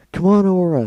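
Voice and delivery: high, loud desperate plea; pleading. Acted. Skulu begging Aura to escape with her, loud plea. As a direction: beg her to move now. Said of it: "Skulu pleaded".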